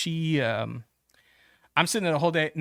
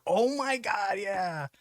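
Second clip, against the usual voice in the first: sudden realization; 7 semitones higher, slower than usual. Retold or imitated. Sudden memory.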